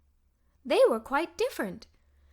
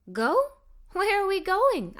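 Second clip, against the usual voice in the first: puzzled question; 6 semitones higher than usual. A narrator's reading. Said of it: "I questioned".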